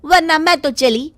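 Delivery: the wife stands her ground; firm haggling wife. Acted. Sudha firm bargaining. (पक्की मोलभाव वाली आवाज़)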